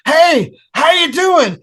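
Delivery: high, loud stage greeting; calling to crowd. Retold or imitated. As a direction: re-enact hailing a stadium from the mic. Greeting stadium crowd from the mic.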